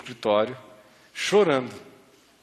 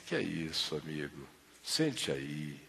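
lower, softer calming words; calm father; 8 semitones lower, 9 dB quieter than usual. Retold or imitated. Father calmly tells the gunman to sit, soft and low. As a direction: settle the armed man down gently.

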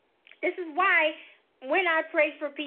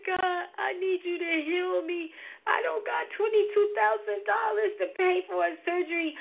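desperate prayer; 7 semitones higher than usual. Retold or imitated. In pain, she begs God for healing she can't afford.

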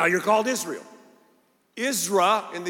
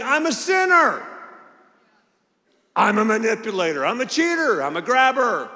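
loud confession; 3 semitones higher, 4 dB louder than usual. Retold or imitated. Jacob confessing his true identity.

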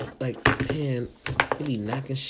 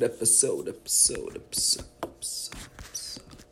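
repeat, dropping to a whisper; fading to whisper; 2 semitones lower, 5 dB quieter than usual. Real, not acted. The comedian signing off toward the next episode, fading out. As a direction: fade each repeat out into a whisper.

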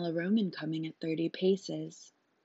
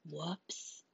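sheepish whoops; 13 dB quieter than usual. A narrator's reading. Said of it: "said the saver".

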